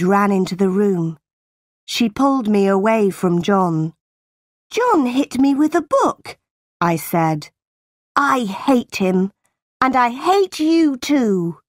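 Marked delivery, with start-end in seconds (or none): 4.7-6.4 high-pitched accusation; indignant protest. A narrator's reading. Jane protests to her aunt. 8.2-11.6 slow, emphatic outburst; angry defiance. A narrator's reading. Jane defies her aunt.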